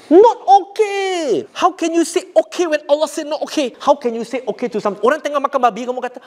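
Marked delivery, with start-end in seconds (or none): 0.1-5.2 emphatic rebuke. Real, not acted. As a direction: stretch out the outrage. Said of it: "Be firm".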